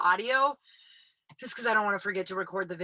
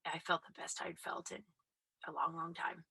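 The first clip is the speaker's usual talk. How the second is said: whisper; 2 semitones lower, 10 dB quieter than usual. Real, not acted. Soft confiding aside.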